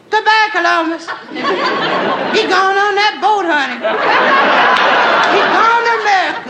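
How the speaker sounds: high excited squeal; excited girls. Acted. Girls seeing him off at the pier, excited high squeal. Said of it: "excited and screaming".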